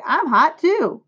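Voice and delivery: put-on high-pitched boyish call; storybook kid calling for attention; crying out. A narrator's reading. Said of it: "cried Avery".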